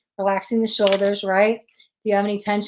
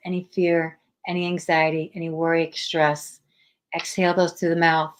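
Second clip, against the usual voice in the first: guided meditation. Acted. Leads guided breathing meditation.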